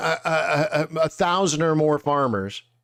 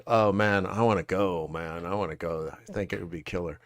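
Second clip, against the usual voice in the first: soft, lower voice to yourself; wistful thought said half under breath; hushed, low private wish; quiet inner longing; 9 semitones lower, 7 dB quieter than usual. Retold or imitated. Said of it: "I just thought".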